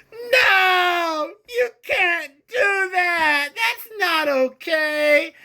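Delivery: shrill high shouting, slow and whiny; hammed-up outraged squeal; cartoon of a party screaming no; mocking Republicans. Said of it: "The Republicans say".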